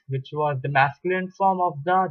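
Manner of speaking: ordinary talk, nothing marked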